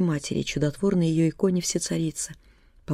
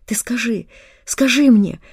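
rising, louder pleading; desperate plea; 6 semitones higher, 9 dB louder, slower than usual. A narrator's reading. Daughter pleading loudly with her raving mother. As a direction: beg her to answer.

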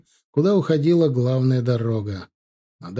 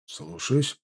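soft, deferential acknowledgement; 5 dB quieter than usual. A narrator's reading. Staff officer bowing to the general, soft deference. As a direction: acknowledge the order softly. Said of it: "преклонил голову".

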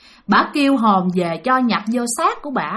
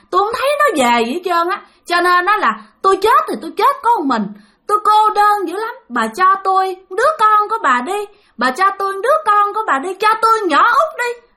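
demanding ghost; 7 semitones higher than usual. Acted. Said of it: "bà nói rằng á là".

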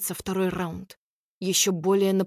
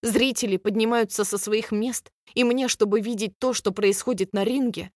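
ordinary delivery, nothing marked

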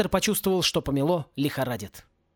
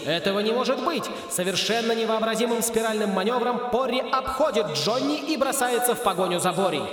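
higher, lively sports commentary; race commentator; 5 semitones higher than usual. A narrator's reading. A race commentator shouting over the broadcast, lively play-by-play.

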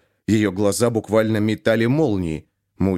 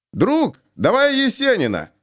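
shouted request; 12 semitones higher than usual. A narrator's reading. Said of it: "раздался мужской голос".

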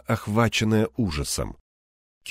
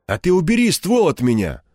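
higher-pitched gruff retort; недовольное бурчание; 7 semitones higher, 4 dB louder than usual. A narrator's reading. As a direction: grumble it out, pushing back at the other man. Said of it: "буркнул он". (недовольно буркнуть в ответ)

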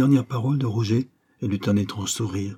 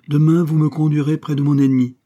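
loud, raised commanding voice; imperious order; 6 semitones higher, 8 dB louder than usual. A narrator's reading. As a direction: order your sons about, brooking no refusal. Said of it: "ordonnait impérieusement la fille des Orsini". (ordre impérieux, voix haute et forte)

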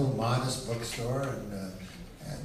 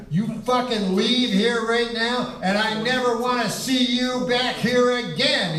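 higher mock furious order, slow; angry shopkeeper; 9 semitones higher, 4 dB louder than usual. Bookseller ordering the customer out, mock fury. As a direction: throw him out, hammed-up. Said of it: "the fucking Mexican guy goes".

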